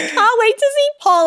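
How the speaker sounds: high-pitched gushing, retold; eager anticipation. Housemate retelling her crush to the host, excited. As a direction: mimic giddy excitement.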